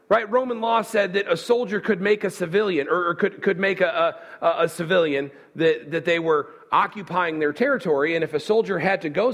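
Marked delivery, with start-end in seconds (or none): none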